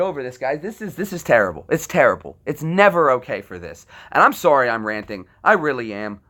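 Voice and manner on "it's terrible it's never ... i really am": normal talking voice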